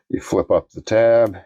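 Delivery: ordinary delivery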